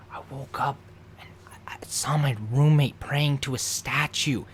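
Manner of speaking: unvoiced tattletale whisper. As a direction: whisper it like telling a secret.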